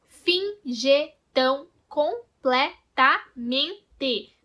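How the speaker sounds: Finge tão completamente spoken very slowly, one syllable at a time; split into syllables. Shown as a demonstration. Teacher counting the eight syllables for the class, slow and separated. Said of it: "teria oito sílabas".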